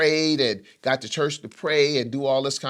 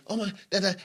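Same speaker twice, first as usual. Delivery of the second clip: stammering, higher-pitched imitation of flustered talk; stumbling speech; 8 semitones higher, much faster than usual. Man imitating a tearful, stumbling phone conversation, stammering. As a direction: stumble over broken syllables, higher. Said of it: "conversation where you're stumbling".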